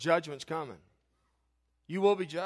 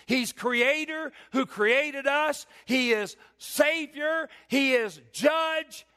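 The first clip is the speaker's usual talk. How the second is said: higher, slow, shouted declaration; slow climactic proclamation; 9 semitones higher, 3 dB louder, much slower than usual. Acted. Preacher proclaims God to the listeners in slow shouts. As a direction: hammer out each title slowly.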